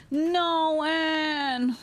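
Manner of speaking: high, pleading wail; dismayed protest. Real, not acted. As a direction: cry out against a heartbreak. Streamer protests at the breakup letter.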